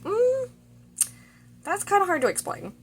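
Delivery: high, put-on voice dodging the question; a coy put-on voice brushing her off; cagey evasive guy. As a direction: squirm away from answering in a lifted voice. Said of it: "He's like".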